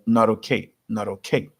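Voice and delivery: "not okay" with the t tapped like a quick r, "naroke"; flapped t like naroke. Shown as a demonstration. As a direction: link "not okay" with a fast D. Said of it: "parece um R".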